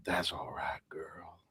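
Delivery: soft, low, whispered coo to a horse; sultry horse talk. Retold or imitated. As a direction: murmur it like a seduction. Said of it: "talking like that".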